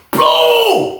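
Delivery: shouted high punch noise. Retold or imitated. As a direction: blast out the sound of the hit. Imitating punch landing.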